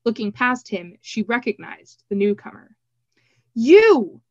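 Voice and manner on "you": loud, high exclamation of recognition; startled cry. A narrator's reading. Triss recognizing the newcomer, crying out. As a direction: cry out sharply on one word. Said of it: "she cried".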